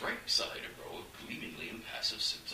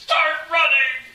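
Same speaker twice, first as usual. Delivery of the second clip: slow, loud, much higher shout; urgent shout; 17 dB louder, much slower than usual. A narrator's reading. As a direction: yell it in terror. Fleeing after the host's death.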